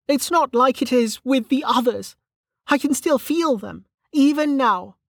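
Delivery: high-pitched distressed character voice; Velika, distressed. Acted. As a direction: insist on the bad news, pitched high.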